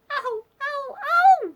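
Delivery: high, loud gasping cries; overcome Amanda. A narrator's reading. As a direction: squeal helplessly between giggles.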